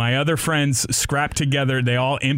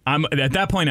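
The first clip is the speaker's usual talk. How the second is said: ordinary delivery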